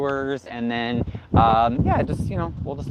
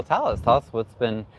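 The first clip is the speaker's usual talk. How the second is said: normal talking voice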